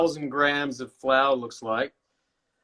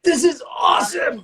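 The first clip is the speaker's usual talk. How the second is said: excited shout; 11 semitones higher than usual. Real, not acted. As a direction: cheer it out like a fan. Excited cohost cheering mid-conversation.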